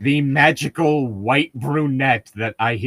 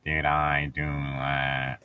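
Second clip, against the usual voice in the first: a quiet, much lower deadpan echo; lower, slow mock echo; 10 semitones lower, 9 dB quieter, much slower than usual.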